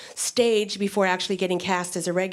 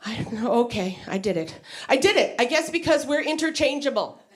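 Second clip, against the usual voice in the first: ordinary talk, nothing marked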